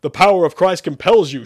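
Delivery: loud, high exorcist's command; roar. Acted. Priest chants at the demon, roaring. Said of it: "Sherman roared".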